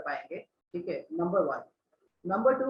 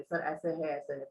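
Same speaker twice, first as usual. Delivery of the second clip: mocking a slouched student; 4 semitones lower, 8 dB quieter than usual. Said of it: "हम लीन करके बात करने लगते हैं सर से".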